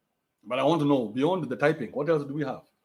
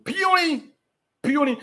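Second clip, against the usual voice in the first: mocking; 10 semitones higher, slower than usual. Real, not acted. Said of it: "How puny are you?".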